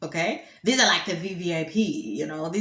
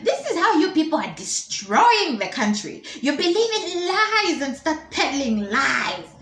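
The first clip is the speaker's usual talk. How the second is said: acted indignant scolding; 8 semitones higher, 4 dB louder than usual. Minister blames reporter for destroying country, hammed-up outrage. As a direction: lay into the press as an outraged elder.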